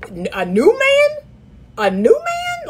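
high disbelieving squeal; incredulous. Real, not acted. Coach echoing a DM in high disbelief. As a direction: repeat it in shocked disbelief.

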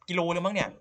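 high, loud disbelief; loud high amazed outburst; astonished. Real, not acted. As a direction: exclaim at how far you dug. Shocked at long tunnel.

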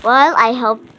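usual speaking voice

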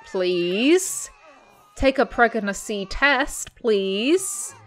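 ordinary delivery

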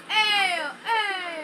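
crying out. Real, not acted. Calling out crying voice.

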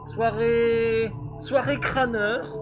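muffled through a face mask; behind welding mask. Real, not acted. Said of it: "la voix étouffée avec ce masque".

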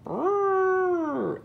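high, loud imitated elephant trumpeting; long trumpeting blare, soaring then dropping. A narrator's reading. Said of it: "Elephant trumpets".

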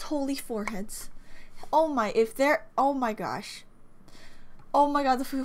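normal talking voice

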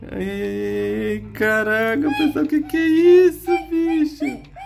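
high scared wailing; frightened whimpering. Real, not acted. Monster nearby, panicking.

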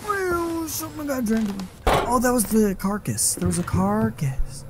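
very high silly squeaky voice; goofy voice. Acted. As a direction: go high and cartoonish. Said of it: "I just put on a goofy voice".

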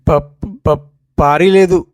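stumbling start, then a high loud blurt; flustered stammer. Acted. Boy caught out by his father's question, stammering. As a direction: trip over the first syllable nervously.

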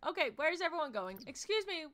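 fast flustered shout; exasperated call. Real, not acted. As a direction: call the guests back, flustered. Guests walking wrong way.

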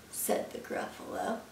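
usual speaking voice

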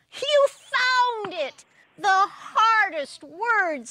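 high, loud, slow jubilant exclaim; joyful. Acted. God rejoicing at Ziz's apology, jubilant. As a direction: celebrate the answer. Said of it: "said God".

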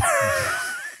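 very high-pitched mimicked toddler squeal; mock toddler squeal. Dad mimics daughter after moving her away, mock squeal. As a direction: imitate a toddler's protesting squeal. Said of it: "She did not like that".

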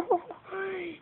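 high startled laugh. Real, not acted. Gamer laughing off the scare.

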